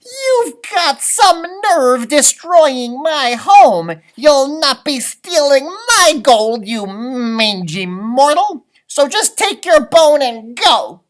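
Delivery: loud, high, slow furious scolding; tiny man giving a giant dog a piece of his mind; angry leprechaun. Acted. Leprechaun berating the dog for wrecking his home.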